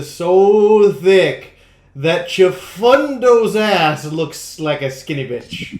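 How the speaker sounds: slow, loud punchline; loud comic proclamation. Pretend. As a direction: belt the punchline out slowly. Restarts pizza joke much louder.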